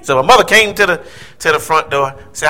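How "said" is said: ordinary talk, nothing marked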